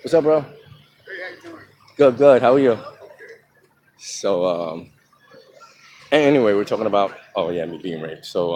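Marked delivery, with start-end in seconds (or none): 0.0-2.8 higher and louder, laughing fake-friendly hello; forced nice greeting. Greeting a bro on the street, fake friendly cheer. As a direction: play the buddy with put-on cheer. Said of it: "But you gotta be nice".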